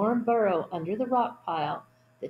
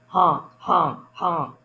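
louder honking goose call; goose honks; 2 semitones lower, 6 dB louder, slower than usual. Acted. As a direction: honk like wild geese. Geese noise in picture book.

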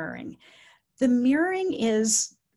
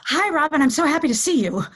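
loud, high, rushed hello; over-bright tense greeting; 6 semitones higher, 8 dB louder, faster than usual. Shown as a demonstration. Caregiver greeting Robin after a stressful morning, over-bright. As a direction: gush a hurried, too-loud greeting. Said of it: "is not what's coming across".

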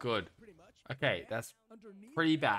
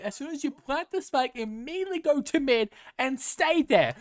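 real furious shouting, much higher and louder; exasperated yelling at a pointless mistake; angry rant; 9 semitones higher, 9 dB louder than usual. Real, not acted. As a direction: tear into the player's rotation at full volume. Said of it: "I'm getting angry".

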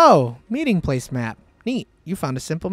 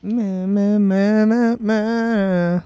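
higher, drawn-out repeated "ma"; playful sustained vocal noodling; silly nonsense syllables; 4 semitones higher, 4 dB louder, much slower than usual. Pretend. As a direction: chant baby-like nonsense, pitched up. Making silly noises.

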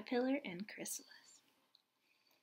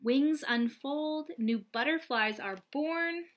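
bright, louder read-aloud storytelling voice; picture book reading; 3 semitones higher, 5 dB louder than usual. Acted. Reading a butterfly picture book to young children, lively and bright.

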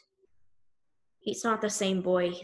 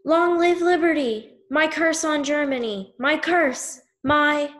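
high, very loud cry of defiance; defiant shout; 7 semitones higher, 8 dB louder than usual. A narrator's reading. Condemned boy on the gallows shouting defiance at Germany, full-throated yell. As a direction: scream it with your last breath. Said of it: "The young boy shouts".

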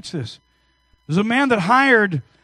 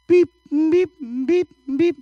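high mechanical beep, beep; high-pitched electronic beeps; machine beeps; 8 semitones higher than usual. Retold or imitated. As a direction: imitate a hospital monitor beeping. Imitating hospital breathing machine.

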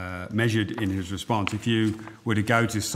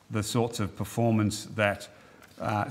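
ordinary talk

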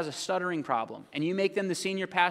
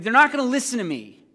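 higher, louder objection; protesting; 6 semitones higher, 6 dB louder than usual. Acted. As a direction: object loudly as the reluctant messenger. Acting Moses objecting to God.

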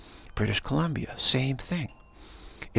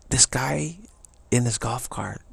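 ordinary talk, nothing marked

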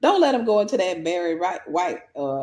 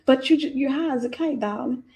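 Southern accent; 3 semitones higher than usual. Shown as a demonstration. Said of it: "break that Southern accent out on you".

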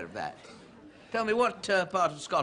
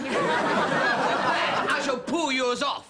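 slow, loud, higher shouted threat; furious threat; 9 semitones higher, 4 dB louder, much slower than usual. Acted. Peter threatens to pull off Hamish's wig, shouting. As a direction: bellow the threat across the room.

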